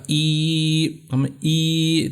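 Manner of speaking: ای held as a long "eee"; ای as a sustained long i; stretched long vowel. Shown as a demonstration. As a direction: draw out ای slowly. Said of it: "ہم کھینچ کر پڑھیں گے".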